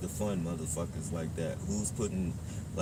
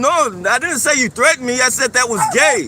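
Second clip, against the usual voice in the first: loud, insistent correction; loud call-out; 16 dB louder than usual. Real, not acted. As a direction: shout back the correction. Arguing with a stranger.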